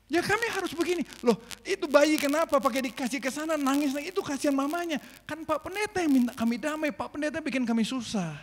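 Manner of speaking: lightly voiced, blame the pastor; complaining villagers. Villagers blaming the pastor for their grief.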